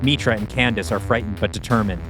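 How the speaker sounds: ordinary delivery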